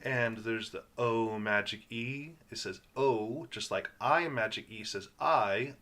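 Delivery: ordinary delivery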